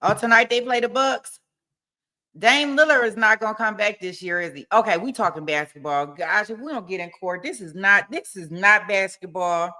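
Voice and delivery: usual speaking voice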